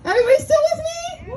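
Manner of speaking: high, fast crowd shout; hype shout. Shown as a demonstration. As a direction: pump up the crowd.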